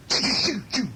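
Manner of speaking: rapid playful "choo" sound effect; imitated zapping. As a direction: fire off little puffs, one by one. Imitates spots flying to the tree.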